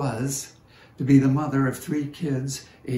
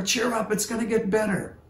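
bright, hammed-up chirpy cheer, higher and fast; breezy mock cheer; 9 semitones higher than usual. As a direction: brush off the sadness with a sing-song pep talk. Mock cheering up a sad person.

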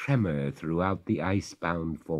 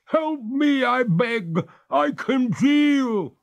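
high, slow, louder freezing ghost; 7 dB louder, slower than usual. Acted. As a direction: complain through the cold, slow and high.

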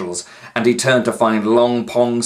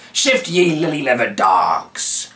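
slow, louder pirate command; rough pirate order; 4 semitones higher, 5 dB louder than usual. A narrator's reading. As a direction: growl it at the prisoners.

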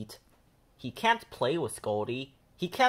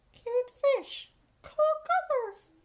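classmate voice; 3 dB louder, slower than usual. A narrator's reading. Classmates praising painting.

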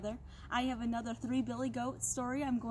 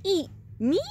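high, loud incredulous question; startled little goat; 7 semitones higher, 8 dB louder than usual. A narrator's reading. Little goat answers the threatening troll, startled and high. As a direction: yelp it back in surprise.

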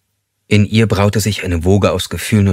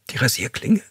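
tearful question; 6 semitones higher, 4 dB quieter than usual. A narrator's reading. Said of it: "Sie weinte los".

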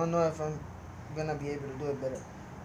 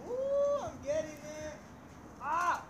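excited; 11 semitones higher, much slower than usual. Real, not acted. Kite catching wind on roof.

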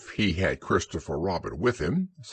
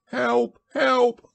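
high, squeaky cries for help; panicked Piglet cry; 6 dB louder than usual. A narrator's reading. Piglet sees the monster and cries out, high and panicked. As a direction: cry out in small-creature panic. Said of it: "cried Piglet".

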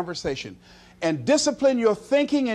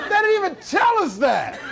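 higher, laughing outcry; laughing exclamation; 8 semitones higher than usual. Acted. Preacher to crowd, laughing outcry. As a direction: let the laugh break through.

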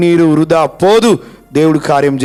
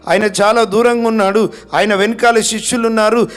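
rebuking; 7 semitones higher than usual. Retold or imitated. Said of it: "గద్దించారు".

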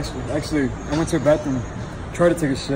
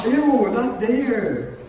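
very slow, high disgusted "ew"; 7 semitones higher, much slower than usual. Real, not acted. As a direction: drag it out in disgust. Disgusted at vomit.

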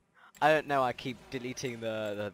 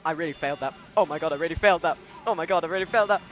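loud, higher wail at own failure; frustrated yelling; 5 semitones higher, 12 dB louder than usual. Real, not acted. Gamer yelling at own failed play, loud. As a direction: shout it out, rising in pitch.

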